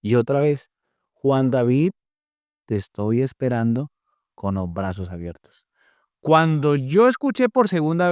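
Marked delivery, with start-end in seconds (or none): 1.1-5.7 retold voice of God, close and solemn; voice at the ear. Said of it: "quiero escuchar nuevamente la voz".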